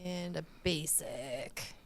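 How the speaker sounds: hushed whisper of the roll. Real, not acted. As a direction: breathe it out under the breath. Whispered dice result.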